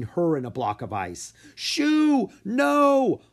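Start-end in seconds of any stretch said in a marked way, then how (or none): 1.5-3.2 high, loud cry of dismay; anguished cry. A narrator's reading. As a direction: cry out as she is frozen. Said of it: "Bo cried".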